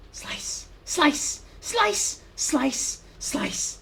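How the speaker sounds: high, loud, sharp shouts with each strike; battle cries. Acted. Fighter shouting with every slash at the beasts. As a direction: bark each slice as you cut.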